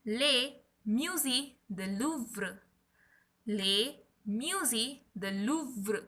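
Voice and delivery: "Le Musée du Louvre" spoken slowly, each word apart; slow classroom drill of "Le Musée du Louvre"; slow repeat-after-me. Shown as a demonstration. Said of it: "Spell with me".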